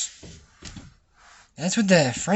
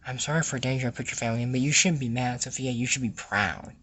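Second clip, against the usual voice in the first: low, quiet consoling voice; acted gentle apology; soft apology; 6 semitones lower, 11 dB quieter than usual. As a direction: apologise softly and reassure.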